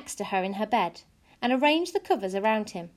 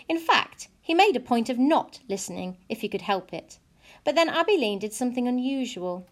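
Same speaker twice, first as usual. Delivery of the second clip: plain reading voice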